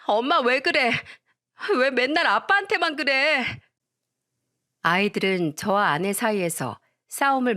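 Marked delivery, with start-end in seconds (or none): 0.0-3.5 put-on higher child voice pleading with mom; little kids begging mom to stop; children's voices. Children defending dad from mom. (엄마를 말리는 아이들 목소리)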